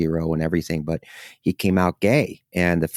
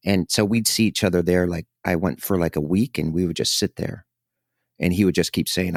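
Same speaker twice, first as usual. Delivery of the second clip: ordinary delivery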